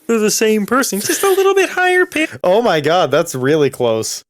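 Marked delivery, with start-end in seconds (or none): 0.1-2.3 high, thin frog puppet voice; Kermit the Frog voice. Retold or imitated. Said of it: "eerily similar to the Kermit the Frog impression".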